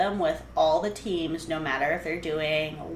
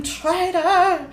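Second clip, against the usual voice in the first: mock outrage; 12 semitones higher, 7 dB louder than usual.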